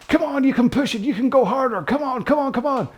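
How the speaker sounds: high, hyped-up American trainer yelling encouragement; American coach voice. Pretend. Rowing coach mock-urging viewers on in an American accent. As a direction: pump up the rower like an American gym coach. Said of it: "Don't know why I'm being American".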